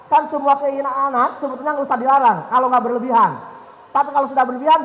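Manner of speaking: ordinary talk, nothing marked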